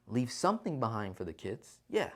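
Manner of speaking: normal talking voice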